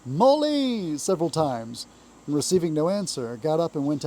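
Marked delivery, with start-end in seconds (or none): 0.0-1.0 loud call, high and rising into the name. A narrator's reading. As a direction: call across the house for a friend. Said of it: "Then he called".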